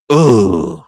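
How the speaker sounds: loud, drawn-out groan of disgust; exasperated groan. Acted. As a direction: groan it out loudly, fed up. Reacting to pay dispute scenario.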